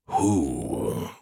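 low, slow machine voice; cold mechanical AI voice; robot voice. A narrator's reading. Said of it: "came a robotic voice".

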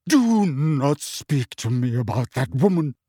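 slow, sharp, wounded; bristling at the very name; cried out. A narrator's reading. Trelawney rounding on Parvati, crying out. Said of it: "cried Professor Trelawney".

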